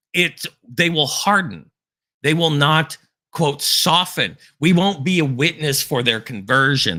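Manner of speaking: fervent preaching. Acted. Preacher warning listeners the faithful won't convert them, impassioned preaching. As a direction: drive the warning home from the pulpit.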